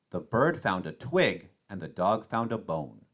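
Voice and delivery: ordinary delivery, nothing marked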